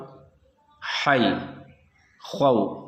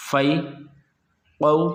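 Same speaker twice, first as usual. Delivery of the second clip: ordinary talk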